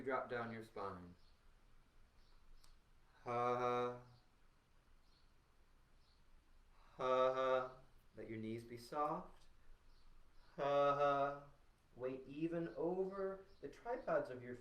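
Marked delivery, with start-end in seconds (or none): 3.3-7.7 short punched ha sounds; touch sound with a ha; vocal warm-up ha. Shown as a demonstration. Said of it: "As you touch sound". 10.6-11.3 short punched ha sounds; touch sound with a ha; vocal warm-up ha. Shown as a demonstration. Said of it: "As you touch sound".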